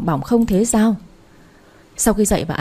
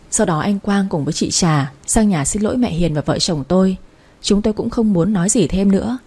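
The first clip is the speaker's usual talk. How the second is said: plain reading voice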